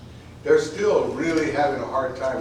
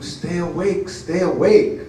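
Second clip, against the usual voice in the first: screaming plea; 6 semitones higher, 8 dB louder, slower than usual. Acted. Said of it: "And I scream".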